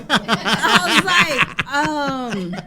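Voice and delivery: breaking into high laughter mid-sentence; can't get the words out for laughing; laughing hard. Real, not acted. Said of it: "I'm laughing so fucking hard".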